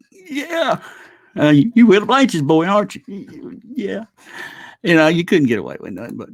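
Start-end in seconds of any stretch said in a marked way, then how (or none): 0.1-0.8 high, meek little yes; young boy's timid yeah; sheepish boy. Retold or imitated. Boy answering nosy townsfolk. 1.4-3.6 higher, louder folksy quiz; a hint of a prying country neighbour; nosy old townsman. Townsman quizzing the boy. 3.6-4.1 high, meek little yes; young boy's timid yeah; sheepish boy. Retold or imitated. Boy answering again.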